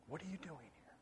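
breathy whisper, barely audible; gentle whisper. A narrator's reading. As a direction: whisper it like the still small voice. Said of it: "he hears a gentle whisper".

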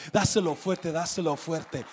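rally them to shout; preacher's rousing call; urging shout. Real, not acted. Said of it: "preacher urging congregation".

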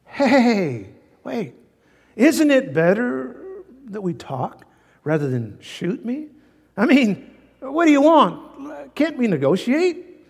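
a persuasive bear trying to talk his way out; smooth-talking bear. Acted. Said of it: "the bear spoke in a soft, smoothing voice".

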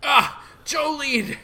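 high, stretched cry for help; acted distress cry. Friend seized by ghost, calls in distress. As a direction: cry out as if grabbed.

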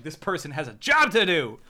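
loud, exasperated urging; impatient outburst. Retold or imitated. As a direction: hurry them up, fed up and loud. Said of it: "and I'm like".

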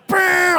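high, loud lip-buzz of a speeding car; car engine zoom. Retold or imitated. Preacher mimicking a driver zooming past the line, loud engine buzz.

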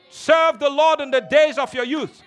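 full-voiced shout over the music; a fiery preaching cry; preacher's shout. Real, not acted. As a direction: shout it to the congregation. Repeated exhortation, shouted.

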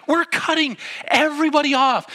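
high, loud disbelieving shout; mock-indignant outburst; outraged protest. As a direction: act out an appalled passenger yelling. Acted argument, passenger protests.

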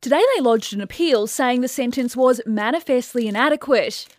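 crisp, measured newsreader delivery; news voice. Shown as a demonstration. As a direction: read it like the evening bulletin. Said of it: "Give me something to read in news voice".

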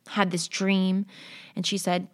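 ordinary talk

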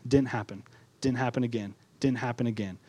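soft and heavy, sinking each time; weary letdown. Real, not acted. Preacher recounting years of disappointment, softly.

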